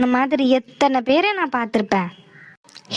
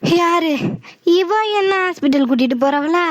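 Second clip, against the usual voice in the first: usual speaking voice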